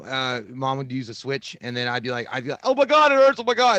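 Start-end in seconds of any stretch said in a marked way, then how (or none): 2.6-3.8 high, loud fake wail; badly faked sobbing protest of pain; fake crying. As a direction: bawl it to get out of trouble. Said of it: "I would just fake cry".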